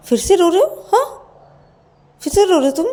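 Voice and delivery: high, loud accusing question; sharp scolding. Acted.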